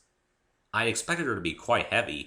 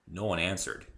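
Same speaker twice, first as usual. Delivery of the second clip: usual speaking voice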